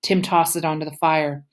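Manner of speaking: ordinary delivery, nothing marked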